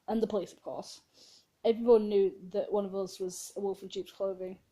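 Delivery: ordinary talk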